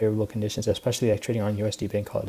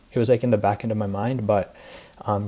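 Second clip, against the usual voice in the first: ordinary talk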